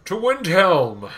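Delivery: slow, high, loud battle call; heroic rallying cry. Pretend. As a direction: proclaim it like a hero leading troops.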